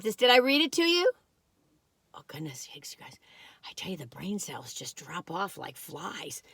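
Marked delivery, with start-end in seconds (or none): 2.1-5.3 embarrassed little whisper; whispered aside. Real, not acted. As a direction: whisper it to yourself, sheepish.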